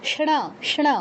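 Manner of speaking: ষণা as sha-ṇa, curled-back n; retroflex cluster. Shown as a demonstration. Teacher isolating the cluster, ষণা with retroflex n. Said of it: "এরকম".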